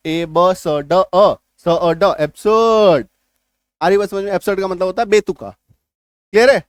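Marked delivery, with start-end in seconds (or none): 0.1-2.1 ए ब स ड अ slowly separated, no R; sound by sound. Shown as a demonstration. Teacher breaking the word down, स अ ड one at a time. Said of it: "गलत". 2.2-3.1 एब्सर्ड with long last vowel, "ab-suuhd"; silent R. Shown as a demonstration. Teacher summing up the sounds, एब्सर्ड with silent R. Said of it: "गलत".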